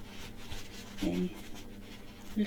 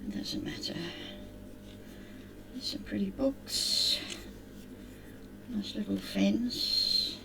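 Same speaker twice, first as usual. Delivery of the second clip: soft ASMR whisper; hushed close whisper; 3 dB quieter than usual. Acted. Whispered crafting commentary.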